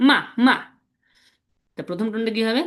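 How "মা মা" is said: "মা" punched short, pitch plunging; pushed falling tone. Shown as a demonstration. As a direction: give "মা" a push and let it drop. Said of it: "একটু ধাক্কা দিয়ে বলবো". ("মা" ধাক্কা দিয়ে নিচে নামা সুরে)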